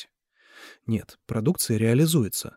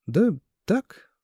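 vague; 9 semitones higher than usual. A narrator's reading. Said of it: "неопределённо ответил парень".

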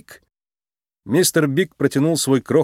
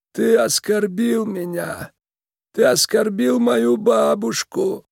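creaky, wounded mob boss; 9 semitones higher than usual. Acted. Tiny boss reproaches Nick for broken trust, creaky and commanding. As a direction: lay out the betrayal in a measured creak.

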